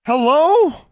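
high, loud call into the dark; shouting. A narrator's reading. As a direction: shout it out into the darkness. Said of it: "Alexandra shouted".

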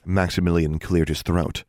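usual speaking voice

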